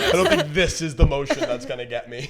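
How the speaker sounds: ordinary delivery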